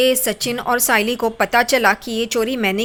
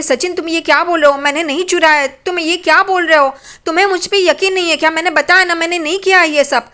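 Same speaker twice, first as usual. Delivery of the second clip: high, pleading protest; wife pleads innocence to her husband, high and upset; upset denial; 5 semitones higher than usual. Acted. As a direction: push back, hurt and insistent. Wife denies theft, upset.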